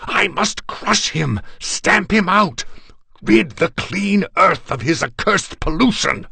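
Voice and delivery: loud high raving, building to a frenzy; frenzied, high-pitched full-voiced tirade; enraged screaming. A narrator's reading. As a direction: scream it while struggling to get free. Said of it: "I screamed".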